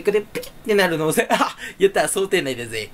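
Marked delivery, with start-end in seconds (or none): none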